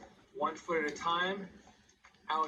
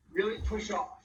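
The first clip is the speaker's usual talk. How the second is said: upbeat, higher coaching countdown; aerobics instructor; 5 semitones higher, slower than usual. Acted. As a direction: pump up the class through the last seconds. Live workout countdown cueing.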